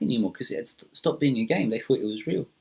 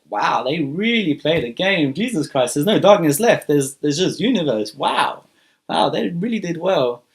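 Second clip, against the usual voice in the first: loud, higher-pitched wonder; amazed exclamation; 5 semitones higher, 6 dB louder than usual. Acted. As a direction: marvel out loud at a huge sight. Awestruck dragon surveys the new universe.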